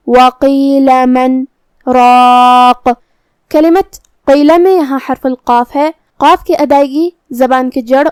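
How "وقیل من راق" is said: brief pause after من without breath; sakta pause. Shown as a demonstration. Teacher demonstrates the sakta between من and راق. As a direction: stop on من with a short silent break, then راق. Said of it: "کلمت من کے بعد کلمت سکتہ لکھا ہے".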